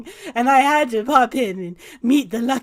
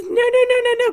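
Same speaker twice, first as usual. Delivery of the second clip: much higher, rapid protest; insistent refusal; 12 semitones higher, 4 dB louder, faster than usual. Acted. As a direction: wave off the exit eagerly. Vander refuses to leave.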